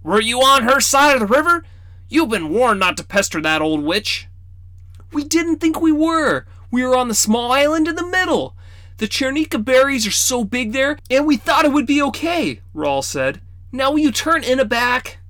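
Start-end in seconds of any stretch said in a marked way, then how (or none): 0.0-4.3 put-on high, scolding old man voice; teasing grandfatherly reproach; stern grandfather. 5.1-12.6 put-on high, defensive boy's voice; a kid explaining himself to grandpa; high boyish excuse. Boy justifies himself. 13.7-15.2 put-on high, defensive boy's voice; a kid explaining himself to grandpa; high boyish excuse. Boy asks grandfather.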